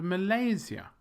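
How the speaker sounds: Malaysia as muh-LAY-zee-uh; middle-syllable stress. Shown as a demonstration. Teacher modelling for learners, middle of Malaysia stressed. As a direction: LAY high and strongest in Malaysia. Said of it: "the stress is in the middle".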